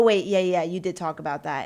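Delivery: ordinary delivery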